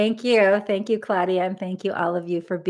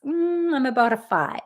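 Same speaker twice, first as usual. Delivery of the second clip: lightly voiced participant pondering, higher pitch; imagined participant; 6 semitones higher than usual. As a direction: muse over your answer, starting high. Voicing participants' chat answers.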